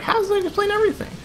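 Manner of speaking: very high, quick disbelieving squeal; high-pitched incredulous comeback; mock indignant squeak. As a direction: squeak the question out fast in mock outrage. Acted indignant reply in retold exchange.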